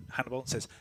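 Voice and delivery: ordinary talk, nothing marked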